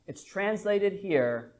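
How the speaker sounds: usual speaking voice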